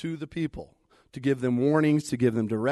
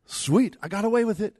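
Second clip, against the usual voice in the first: higher, pleased-with-yourself quote; mock gloating; 7 semitones higher, 4 dB louder than usual. Someone thinking they got away with it, mock gloating. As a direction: act out relief at getting away with it. Preacher voices getting away with wrongdoing.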